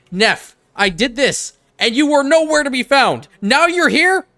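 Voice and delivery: loud, high exasperated yelling; furious rant. Real, not acted. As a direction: vent at him at full volume. Furious rant at found character Neff.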